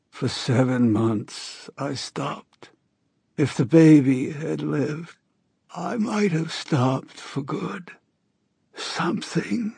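high, slow hoarse voice of a sick woman; a dying woman's rasping plea. A narrator's reading. Narrator voices dying Astrid.

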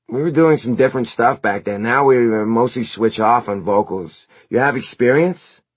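high, loud dude voice; bandmate Eric. A narrator's reading. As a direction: interview the newcomer as a garage-band dude. Plays Eric in the story.

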